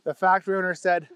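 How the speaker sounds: ordinary delivery, nothing marked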